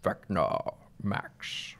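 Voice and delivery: slow, low, whispered villain voice; Vecna voice. Pretend. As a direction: read it as a creepy villain, slowly. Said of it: "how about I read this?".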